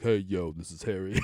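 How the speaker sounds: mock Prince Harry gone American, casual; hint of an American dude voice; imitating Harry's American accent. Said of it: "he now has an American accent".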